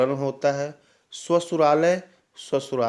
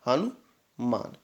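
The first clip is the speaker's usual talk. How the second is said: slow model word; 2 semitones lower, 4 dB quieter, much slower than usual. Shown as a demonstration. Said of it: "Hanuman होता है".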